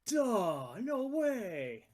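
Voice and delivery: mock realization. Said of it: "Which is where I went".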